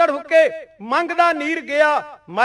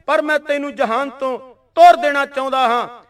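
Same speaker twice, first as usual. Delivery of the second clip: ordinary delivery, nothing marked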